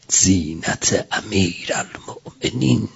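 ordinary talk

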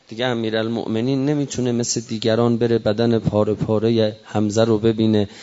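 ordinary talk, nothing marked

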